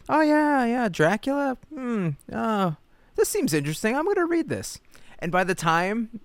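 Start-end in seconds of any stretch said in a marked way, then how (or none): 0.1-4.8 high, put-on voice of a clueless Icelandic reader; mock clueless reader. Host mocking an Icelandic reader who never noticed the rewrite, high put-on voice. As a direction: play a dopey reader who shrugs and picks up the book. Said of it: "was like".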